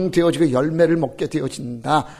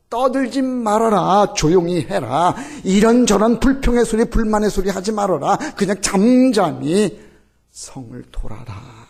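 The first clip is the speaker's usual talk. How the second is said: raised divine order; 5 semitones higher, 3 dB louder than usual. Retold or imitated. Preacher voices God's order.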